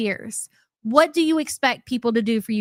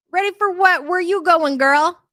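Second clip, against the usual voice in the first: higher, louder mocking disbelief; incredulous; 6 semitones higher, 4 dB louder than usual. Real, not acted. Mocking the streamer getting ready, loud incredulous. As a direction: throw the question back in mock outrage.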